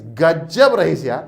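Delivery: loud, slow गजब रहिस यार with गजब stretched; teacher demos the emphasis, drawn out; emphatic gajab. Shown as a demonstration. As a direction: exaggerate गजब to show emphasis. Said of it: "बढ़ा चढ़ा के ओला बोलना है".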